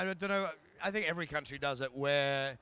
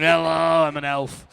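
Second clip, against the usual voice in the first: loud camp Lily Savage greeting; brash drag-queen hello, belted out; 11 dB louder than usual. Retold or imitated. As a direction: camp showgirl voice announcing she's an elf. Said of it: "it's like Lily Savage going".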